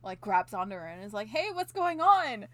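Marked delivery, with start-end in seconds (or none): 1.3-2.5 high, loud, drawn-out call; mock alarmed question. Retelling Daruma grabbing her, mock alarm. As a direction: call out to stop her, slowly.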